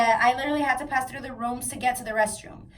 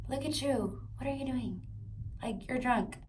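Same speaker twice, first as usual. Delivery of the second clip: hushed, exasperated telling-off; quiet disbelief; 10 dB quieter than usual. Retold or imitated. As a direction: quietly call him out on being drunk. Confronting drunk friend, quoted.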